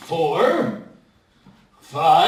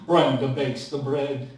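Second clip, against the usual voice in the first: chuckling baker; 8 semitones lower than usual. Acted. Bob answers with a chuckle.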